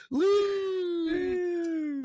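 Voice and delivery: high laugh into a shout; laughing whoop. Real, not acted.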